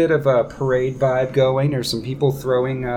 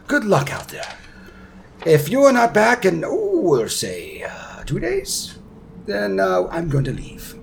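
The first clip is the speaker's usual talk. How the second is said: fussy prince, warning; 7 semitones higher than usual. Acted. Prince Darren warns he will leave without them, fussy hesitation. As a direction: draw out the deadline as you think of it.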